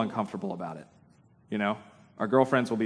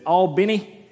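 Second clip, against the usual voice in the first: put-on "Albany" in a louder, higher Georgia accent; Georgian pronunciation; 6 semitones higher, 5 dB louder, slower than usual. Imitating his friend from Georgia, Georgian accent on "Albany". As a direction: say "Albany" like a Georgia native, pitched up. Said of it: "or as he says it".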